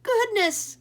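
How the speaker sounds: loud shrieking surprise; exclaimed. A narrator's reading. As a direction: gasp out in shock. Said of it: "exclaimed Cuchulainn".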